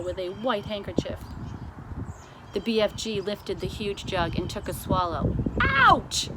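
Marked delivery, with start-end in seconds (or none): 5.6-6.3 very high, loud yelp; BFG pained cry. A narrator's reading. The giant burns his mouth, yelping loud. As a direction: yelp as hot coffee burns. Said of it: "he cried".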